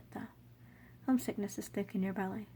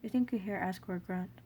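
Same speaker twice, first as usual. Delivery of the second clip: ordinary delivery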